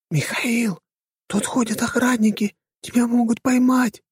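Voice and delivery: high, breathy female stage whisper. A narrator's reading. Prisoner warns the hero about guards, whispering. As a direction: whisper an urgent warning in a high voice. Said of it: "прошептала Герда".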